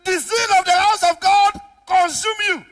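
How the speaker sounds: high, loud pulpit cry; full-force preaching. Real, not acted. Preacher charging the congregation over zeal for God, full-force preaching.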